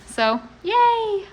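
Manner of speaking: little celebratory yay; excited cheer. Real, not acted. Celebrating the finished wall.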